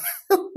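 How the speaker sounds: short, high-pitched burst of laughter. Real, not acted. Streamer amused by viewers' comments, high laugh. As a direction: laugh high at what was just read.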